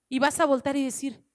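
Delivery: ordinary delivery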